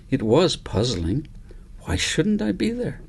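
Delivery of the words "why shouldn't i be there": put-on much higher, softer, a child's puzzled question; puzzled little girl. A narrator's reading. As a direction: wonder aloud like a bewildered five-year-old. Cornelia puzzled in her story.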